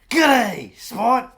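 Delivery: put-on an Irish accent that comes out Australian; Irish accent attempted, Australian slips out; fake Irish accent. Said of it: "do you do an Irish accent?".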